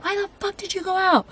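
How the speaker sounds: high-pitched, exasperated mock yell; yelling at a horror movie girl; mock yell at the screen. Viewer yelling at a horror movie character, high-pitched mock yell. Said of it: "the person you're yelling at".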